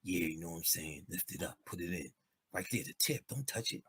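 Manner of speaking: mock bedroom talk, hushed; seductive murmur. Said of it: "when you about to get some pussy".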